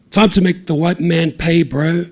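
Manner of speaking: loud, high mocking taunt. A narrator's reading. Taiho taunting the cop, loud and mocking. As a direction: rub the cop's nose in it.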